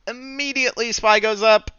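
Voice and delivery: high, incredulous wail; can't believe it just happened; exasperated disbelief. Real, not acted. Put fails, price rises instantly.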